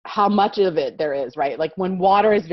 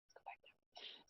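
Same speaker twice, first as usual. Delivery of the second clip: whisper; 27 dB quieter than usual. Real, not acted. Aside while fixing slides.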